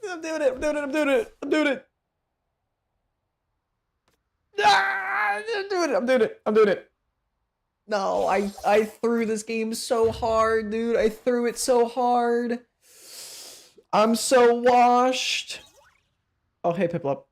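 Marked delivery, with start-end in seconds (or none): 0.1-6.9 very high, frantic squealed chant. Real, not acted. Gamer cheering themself on mid-game, frantic squeal. 7.9-15.6 high, slow, drawn-out lament; despairing whine. Real, not acted. Gamer blaming themself for throwing the game, despairing whine.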